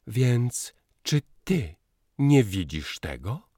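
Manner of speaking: hard, rough, indifferent; hard, curt. A narrator's reading. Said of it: "tak twardo, szorstko, obojętnie".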